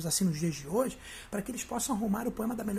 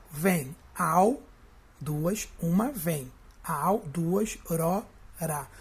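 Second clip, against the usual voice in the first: "vem", "ao", "ro", "ra" as lower, separated beats; counting syllables; 4 semitones lower, much slower than usual. Shown as a demonstration. Teacher counting poetic syllables for students, detached syllables. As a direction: count off each piece of "vem ao" and "ro, ra" with gaps. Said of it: "vou contar quantas sílabas poéticas".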